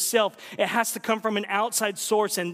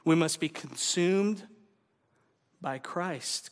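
ordinary delivery

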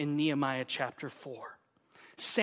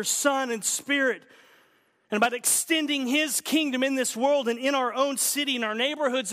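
fervent preaching; 6 semitones higher, 4 dB louder than usual. Real, not acted. Preaching climax on opposition.

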